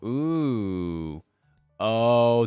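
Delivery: a drawn-out, charmed "ooh"; delighted ooh. Real, not acted. Reacting to the Badger Teemo skin, a delighted coo. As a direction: coo at something adorable.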